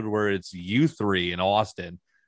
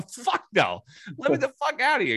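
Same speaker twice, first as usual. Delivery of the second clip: climbing much higher, heated "fuck no"; retold angry refusal; 13 semitones higher than usual. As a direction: snap at security, getting more worked up. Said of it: "I go".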